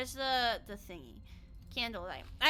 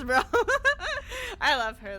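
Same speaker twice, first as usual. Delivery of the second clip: normal talking voice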